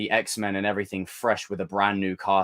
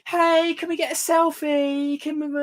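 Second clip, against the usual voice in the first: much higher, slow sugary ask; fawning fan voice; 3 dB louder than usual. Retold or imitated. Same troll meeting you in person, sugary and high. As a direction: beg sweetly for a selfie. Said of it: "they're gonna be like".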